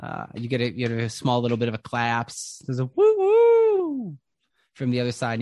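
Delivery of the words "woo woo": high, loud, slow cheering whoop; crowd whoop. Retold or imitated. Crowd cheer from other side.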